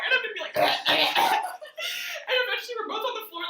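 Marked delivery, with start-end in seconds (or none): 0.6-1.4 loud strangled gagging noise; mimed gags, loud and bursting; imitated choking. Storyteller choking while sister rants, loud gagging.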